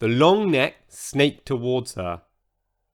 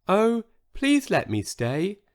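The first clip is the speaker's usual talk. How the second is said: begging tiny voice; 6 semitones higher than usual. Acted. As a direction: beg gently in a little voice. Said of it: "begged Sizo".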